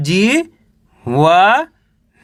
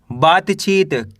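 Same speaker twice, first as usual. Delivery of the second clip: slow reading; much slower than usual. Shown as a demonstration. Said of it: "कैसे पढ़ेंगे?".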